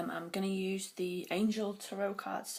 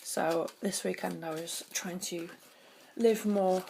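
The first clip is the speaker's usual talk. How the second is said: usual speaking voice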